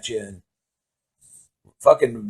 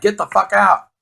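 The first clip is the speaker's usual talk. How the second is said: retold order; 7 semitones higher than usual. Orders friend out of tent.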